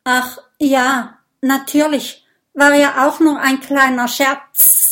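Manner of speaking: a caught-out snake talking its way out; flustered stammer. A narrator's reading. Snake stammers nervously to the dragons. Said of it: "Die Schlange schluckt und stottert".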